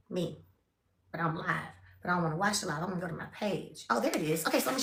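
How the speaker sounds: soft, low under-breath talk; muttering to herself. Real, not acted. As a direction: mumble while poking the phone. Muttering on mama's phone.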